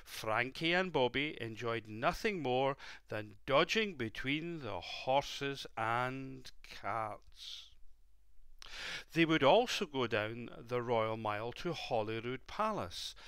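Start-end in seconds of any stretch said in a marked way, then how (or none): none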